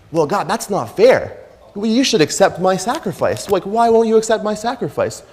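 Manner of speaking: a pretend sulky child protesting to God; whiny complaint. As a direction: pout and whine that it isn't fair.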